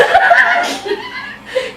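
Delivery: high burst of laughter; high laughing burst. Real, not acted. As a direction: break into a shrill laugh. Laughs at satanist.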